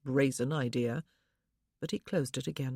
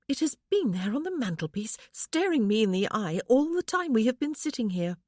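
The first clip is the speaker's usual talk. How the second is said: lightly voiced young wife, higher, wistful; a wife lifted a little higher, yearning; young woman's voice; 6 semitones higher, 5 dB louder than usual. A narrator's reading. Wife tells husband her longing, lightly higher voice.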